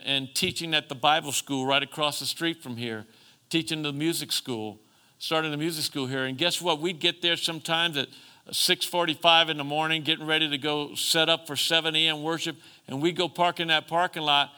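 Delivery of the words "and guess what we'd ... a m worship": ordinary delivery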